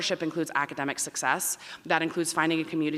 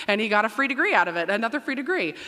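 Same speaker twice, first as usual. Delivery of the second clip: ordinary delivery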